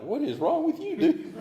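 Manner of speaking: mock outrage at a friend; incredulous. Friends to the sober buddy, high incredulous squeal.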